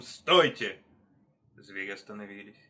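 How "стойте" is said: sharp, loud halt order; one forceful, ringing word; loud commanding cry. A narrator's reading. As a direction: shout to stop the whole crowd. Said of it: "крикнул он своим громким голосом".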